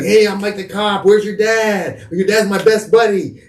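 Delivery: high, sweet put-on voice of a sneaky cop; fake friendly cop. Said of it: "He's like".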